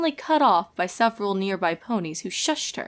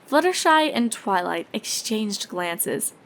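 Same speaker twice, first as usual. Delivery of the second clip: usual speaking voice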